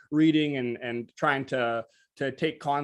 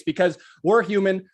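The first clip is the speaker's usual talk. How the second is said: ordinary talk, nothing marked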